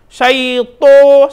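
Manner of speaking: "Syaito" with a rounded O, loud; wrong pronunciation. Shown as a demonstration. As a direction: say "Syaito" the wrong way, mouth pushed out.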